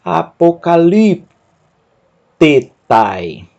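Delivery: very slow, every syllable pulled apart. Shown as a demonstration. Teacher sounds out a long Greek word very slowly. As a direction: break the word into pieces. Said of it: "Eu vou ler com você".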